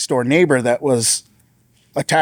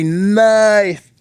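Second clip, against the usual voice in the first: strangled; 8 semitones higher, 3 dB louder than usual. Retold or imitated. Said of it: "I'm trying to say".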